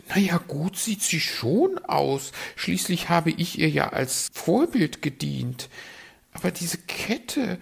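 high, preening bird voice; a vain magpie fishing for compliments. Acted.

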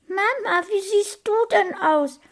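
put-on high, piping little boy voice; bewildered child. A narrator's reading. Small boy seeing his mother as a pig, bewildered. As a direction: ask in high childlike amazement.

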